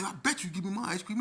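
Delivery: high, loud, heated demand; shouted back in a retold quarrel; defiant demand. Youth demanding ice cream from staffer, defiant shout.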